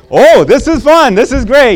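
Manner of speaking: high, loud mock childlike glee; gullible happy kids. Kids buying the parent's fun line, high mock delight. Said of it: "and they're".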